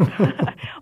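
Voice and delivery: short teasing laugh; a quick amused chuckle. Acted. Sister laughing at her brother's name.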